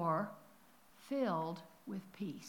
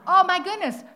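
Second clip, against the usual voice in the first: joyful shout; 10 semitones higher, 17 dB louder than usual. Real, not acted. Healing news, shouting praise.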